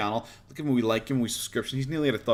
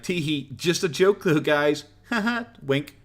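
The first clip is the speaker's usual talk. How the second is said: higher, drawn-out fake-sheepish giggle talk; mock coy begging; 4 semitones higher, slower than usual. Streamer imitating a YouTuber excusing his begging, mock coy. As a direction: play it cutesy, brushing it off as a joke. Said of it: "every time he does it, he's like".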